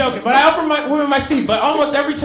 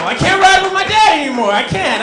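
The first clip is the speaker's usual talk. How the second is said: higher, louder exasperated declaration; fed-up outburst; 7 semitones higher, 4 dB louder than usual. Real, not acted. Comedian on stage fed up with his dad, loud outburst. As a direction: shout it like you've had enough.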